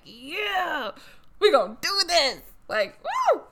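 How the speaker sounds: high, hyped-up whoop of joy; excited pretend cheer. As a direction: act thrilled to be picked. Imagines being chosen by God.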